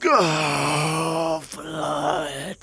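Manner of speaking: a choked, broken repeated cry; anguished cry for blood. Acted. Jealous husband thirsting for blood, anguished cry. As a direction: let the word build into rage.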